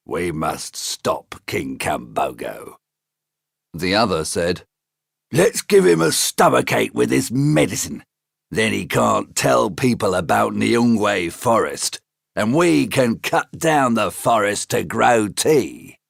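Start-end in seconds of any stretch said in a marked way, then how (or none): 0.1-2.8 low slow scheming bad man; a low, slow conspirator; low, slow villain. 5.3-16.0 scheming villain. Acted. Second bad man plotting.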